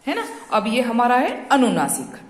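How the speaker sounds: usual speaking voice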